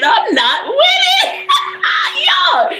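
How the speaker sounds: high, slow, shouted "I'm not with it"; laughing shout. Retold or imitated. Quoting the guy refusing, cracking up. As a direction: bellow it through laughter. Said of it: "he said".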